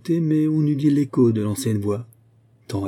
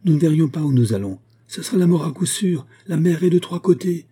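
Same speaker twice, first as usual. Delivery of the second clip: louder, higher urgent warning; crying out; 7 semitones higher, 5 dB louder than usual. A narrator's reading. Constantin warns of the cliff in fog, crying out. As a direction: cry out the danger. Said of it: "s'écria Constantin".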